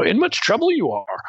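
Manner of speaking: lightly voiced Yoda; Yoda impression. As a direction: speak it like Yoda, words backwards. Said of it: "basically a lot like Yoda".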